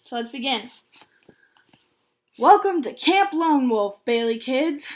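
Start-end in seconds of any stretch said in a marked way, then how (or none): 2.4-4.9 loud, booming camp director; big booming greeting to the kids; booming welcome. Acted. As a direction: boom it across the bus. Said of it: "boomed in a voice loud enough to rattle the bus windows".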